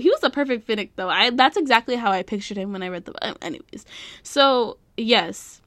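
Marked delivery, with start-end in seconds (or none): none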